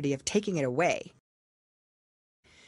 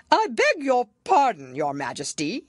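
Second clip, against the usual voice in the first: high, loud Hatter; 7 semitones higher, 6 dB louder than usual. A narrator's reading. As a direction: burst in apologizing. The Hatter apologizes.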